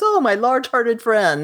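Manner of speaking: high-pitched, gushing mock flirtation; hammed-up suitor. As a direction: play a smitten suitor swooning. Acts the suitor's flirtatious exclamation.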